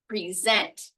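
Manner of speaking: "present" as pree-ZENT, weight on ZENT; stress on second syllable. Shown as a demonstration. Teacher repeats the verb for learners, stress on second syllable of "present". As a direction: hold back, then hit the end of "present", pree-ZENT. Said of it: "depending on how I say it".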